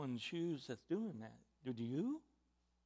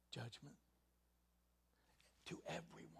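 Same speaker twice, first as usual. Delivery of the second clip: barely voiced, grave warning; quiet, ominous near-whisper; solemn whisper; 15 dB quieter, faster than usual. Real, not acted. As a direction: let the word sink in a hush. Solemn hushed sermon warning.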